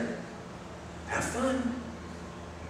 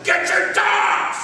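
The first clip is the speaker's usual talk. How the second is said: retold yell; much slower than usual. Preacher in the mud calling owners about attack dogs, panicked yell. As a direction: holler for the owners, dragging out dogs. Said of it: "And I'm yelling".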